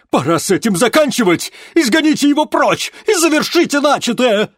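high, loud stern order; harsh command. A narrator's reading. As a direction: order her sharply, no patience. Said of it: "уже гораздо жёстче приказал".

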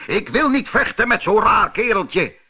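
high, loud mocking refusal; scornful knight. A narrator's reading. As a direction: jeer at a silly little opponent. Knight mocks Harro in the story.

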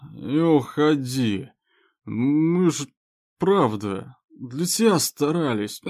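put-on loud slow drunken pleading; town drunk.

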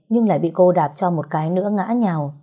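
ordinary delivery

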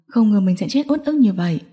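ordinary delivery, nothing marked

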